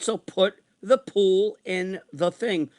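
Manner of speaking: slow, deliberate, word by word; exasperated retold instruction. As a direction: spell out the order slowly, as if retelling it. Retells instructing exasperating woman.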